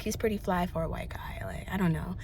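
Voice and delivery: a soft, breathy secret whispered under the breath; whispered confession; whispered aside. Real, not acted. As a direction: let it slip out as a giggly whisper. Giggly aside after praising him.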